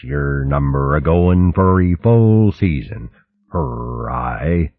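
slow, broad country burr of a mole; rustic mole dialect. Acted. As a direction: drawl it like a homely old mole. Mole speaks in molespeak.

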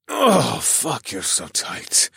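moaning through a bitten lip; moaned. A narrator's reading. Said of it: "I moaned as I bit my lip".

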